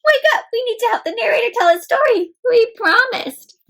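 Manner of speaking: high insistent voice; high eager alien pleading; urgent alien. Acted. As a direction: rouse the sleepy panda, high. Alien waking panda.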